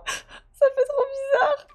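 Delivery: high, slow squeak on the edge of tears; overcome with emotion. Real, not acted. Player back in Anna's house, overwhelmed. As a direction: squeak it out slowly, half laughing, half crying.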